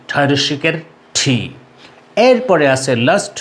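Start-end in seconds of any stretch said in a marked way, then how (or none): 1.2-1.4 "ঠি" with aspirated t; corrected letter name. Shown as a demonstration. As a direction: puff air after the t of "ঠি". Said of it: "এটি হবে".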